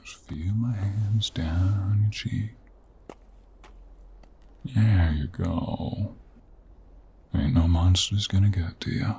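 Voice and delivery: hushed and gentle, lingering on the words; tender reassurance. Acted. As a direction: soothe a frightened animal close up. Soothing the frightened mare.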